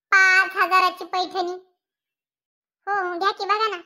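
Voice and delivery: slow, loud scoff at पाच हजाराची; mocking. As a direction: drag out the price in disbelief. Mocking the costly sari.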